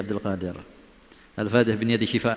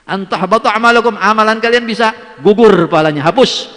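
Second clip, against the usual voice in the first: high loud warning of lost deeds; urgent warning; 11 semitones higher, 11 dB louder than usual. Real, not acted. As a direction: warn them with alarm. (peringatan keras dengan nada tinggi)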